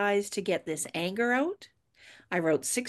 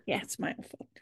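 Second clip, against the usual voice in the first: whispered concession; 7 dB quieter than usual. Real, not acted. Conceding the blame.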